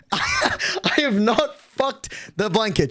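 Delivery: slow, laughing protest; laughing denial. Real, not acted. Streamer denies chat's blanket joke, laughing as he protests. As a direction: break into laughter, then protest slowly.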